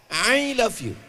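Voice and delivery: "I" mock-pronounced with a throaty Arabic Ain; Ain in English. Shown as a demonstration. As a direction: say "I love you" squeezing "I" from the throat. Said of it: "nggak ada huruf ain". ("I love you" dengan huruf Ain di tenggorokan)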